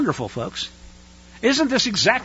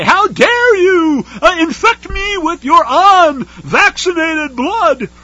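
high, loud, slow shrill mock outrage; outraged Karen; 9 semitones higher, 8 dB louder, much slower than usual. Preacher mocks masked woman scolding the unvaccinated, high and loud. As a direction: play the indignant Karen accusing you. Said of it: "point her finger at you and say".

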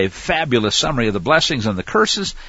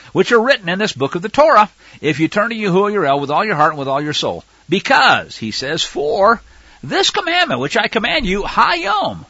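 raised, emphatic reading voice; scripture reading; 7 semitones higher than usual. Acted. Preacher reading Deuteronomy aloud, emphatic. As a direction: proclaim the verse to the flock.

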